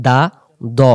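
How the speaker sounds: দা with a thin open vowel, দ with a heavy rounded vowel; correct contrast dal and doad. Shown as a demonstration. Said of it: "উচ্চারণ হবে".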